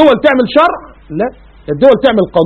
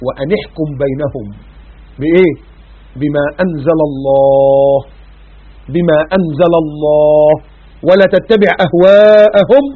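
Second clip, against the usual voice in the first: Quran recitation; 4 semitones lower, much slower than usual. Acted. Recites Surat al-Ma'ida.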